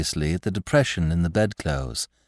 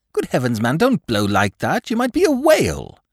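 high, louder mock rebuke; Lord Peter; 8 semitones higher, 4 dB louder than usual. Lord Peter scolding Parker for blowing, high and amused. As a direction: tell him off, rising high on the whale.

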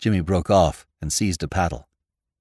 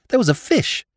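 put-on much higher, like a child; childlike exclamation; 4 dB louder, faster than usual. A narrator's reading. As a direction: blurt it out with childlike simplicity. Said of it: "as a child might have done".

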